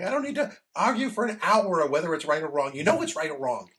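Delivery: loud, high imitation of a fed-up spouse; hammed-up exasperation, high and loud; exasperated objection. Acting out wife's objection to philosophy.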